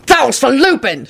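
a hint of yelling; lightly shouted; mild shout. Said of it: "shouted Harry".

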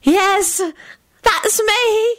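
very high, coy trill; high-pitched sing-out reply. A narrator's reading. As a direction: trill it flirtatiously. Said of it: "she trilled".

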